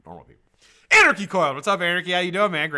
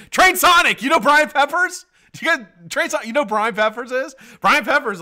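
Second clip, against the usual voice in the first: high, loud hype shouting; loud excited stream opener; 13 dB louder than usual. Real, not acted. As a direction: fire up the chat like a hype man. Hyped stream intro shout-out.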